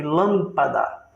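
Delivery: lâmpada with its first syllable lâm strong and long; antepenultimate stress. Shown as a demonstration. Teacher illustrating a proparoxytone, stress on the first syllable. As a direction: lean on lâm, let pada follow lightly. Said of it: "a antepenúltima sílaba forte".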